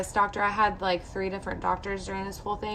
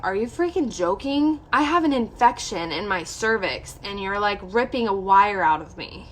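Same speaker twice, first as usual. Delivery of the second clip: indignant disbelief; 4 dB louder than usual. Retold or imitated. Patient retells answering doctor's "wasn't that bad", indignant. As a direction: throw it back at them, incredulous and emphatic. Acted retort to doctor's dismissal.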